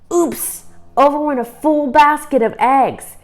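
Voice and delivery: mock alarm. A narrator's reading. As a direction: narrate the spill with a jolt. Ralph kicks over basket.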